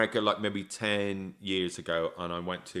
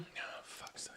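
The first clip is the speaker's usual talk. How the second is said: breathy whispered exasperation; a whispered groan of disbelief; exasperated whisper; 21 dB quieter, slower than usual. Real, not acted. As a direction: mutter it under your breath, fed up. Exasperated aside at Wales.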